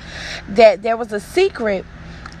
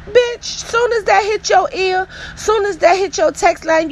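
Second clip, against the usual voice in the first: higher, pitch jumping up on Bitch; outraged exclamation; 10 semitones higher than usual. Real, not acted. Vlogger venting at a disloyal friend, indignant and high. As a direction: fire it off in indignation.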